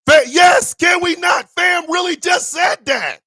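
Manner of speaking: high, loud disbelieving yell; incredulous shout. Real, not acted. Streamer yelling at what fam just said, disbelieving. Outraged reaction to the clip.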